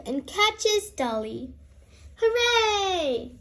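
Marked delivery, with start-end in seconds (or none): 2.2-3.3 high, happy hooray; cheering. Acted. Peppa cheers as the plane catches Dolly: happy high hooray. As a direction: cheer the rescue. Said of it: "cries Peppa".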